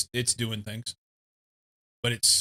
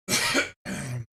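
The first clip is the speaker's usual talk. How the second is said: coughing fit. Real, not acted. Choked on protein bar crumbs.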